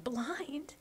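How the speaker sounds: hushed, whispered alarm; acted breathy dismay. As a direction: ask it in a small frightened whisper. Boy frightened of going blind.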